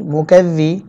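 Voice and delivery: ordinary talk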